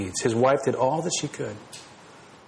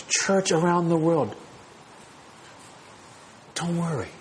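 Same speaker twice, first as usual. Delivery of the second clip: tender shepherd voice; 4 dB quieter than usual. Retold or imitated. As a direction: speak to the congregation as a caring shepherd. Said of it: "God speaking as the shepherd saying".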